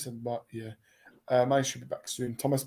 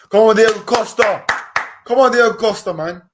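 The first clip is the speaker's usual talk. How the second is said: cheering; 6 semitones higher, 9 dB louder than usual. Real, not acted. Cheering the goalkeeper on.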